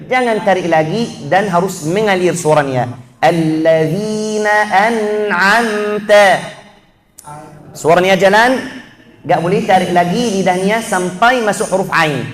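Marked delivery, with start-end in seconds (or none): 3.2-6.5 slow, flowing Alladzina an'amta with the nun held; melodic recitation. Shown as a demonstration. Teacher modelling flowing recitation, slow melodic. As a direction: chant Alladzina an'amta smoothly.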